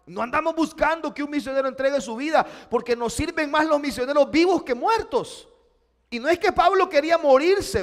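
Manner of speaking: loud, high, fast sermon push; preaching at full force. Acted. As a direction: press the point hard to the crowd.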